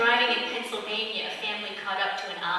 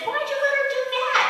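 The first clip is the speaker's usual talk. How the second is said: astonished; 3 dB louder than usual. Acted. Astonished Peter asking the Lord.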